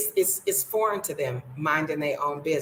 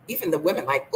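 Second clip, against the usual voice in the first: ordinary talk, nothing marked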